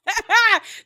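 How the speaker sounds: high, loud cackle; loud laugh. Real, not acted. Host reacts to a castmate's dig, real loud laugh. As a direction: burst out laughing, high-pitched.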